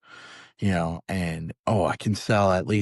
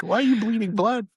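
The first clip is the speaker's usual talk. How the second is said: high panicked quote; 14 semitones higher, 5 dB louder than usual. Retold or imitated.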